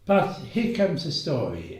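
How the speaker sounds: usual speaking voice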